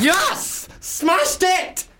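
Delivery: very high, jubilant cry; triumphant cheer. Real, not acted. Comic celebrates nailing the accent, high and triumphant. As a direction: celebrate at the top of your range.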